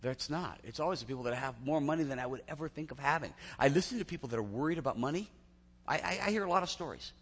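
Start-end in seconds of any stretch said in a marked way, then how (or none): none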